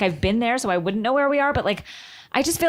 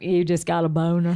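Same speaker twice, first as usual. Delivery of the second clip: lower, deadpan callout of a boner; impression of Chelsea; 5 semitones lower than usual. Mimicking Chelsea calling out her date, lower and deadpan. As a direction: flatly call him out like Chelsea. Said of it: "that's a good Chelsea".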